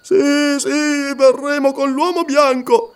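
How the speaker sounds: voce alta e spaventata, balbettata; balbettio tremante. Acted. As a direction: cede terrorizzato alla minaccia. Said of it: "balbettò Lascaro tremando". (Lascaro, terrorizzato, balbetta la resa)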